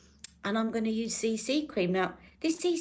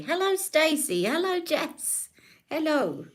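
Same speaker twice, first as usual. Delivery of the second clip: excited greeting; 9 semitones higher, 4 dB louder than usual. Real, not acted. Greeting live viewers.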